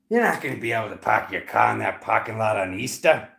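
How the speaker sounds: thick Rhode Island accent, r's dropped. Shown as a demonstration. Joking about the flourishing accent, exaggerated Rhode Island accent. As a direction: play up Rhode Island vowels on park and car. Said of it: "Why is my Rhode Island accent just, like, flourishing?".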